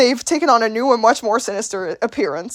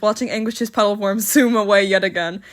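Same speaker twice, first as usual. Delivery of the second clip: normal talking voice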